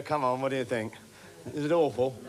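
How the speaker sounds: ordinary delivery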